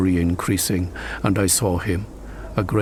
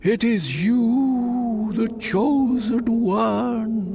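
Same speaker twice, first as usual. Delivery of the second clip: slow, high, eerie spirit voice; ghostly calling; 14 semitones higher, slower than usual. Acted. Nate in the willows calls Gamaliel, slow eerie ghost voice. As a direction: call out like a phantom in the storm.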